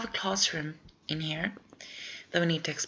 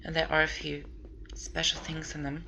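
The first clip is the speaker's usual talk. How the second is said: usual speaking voice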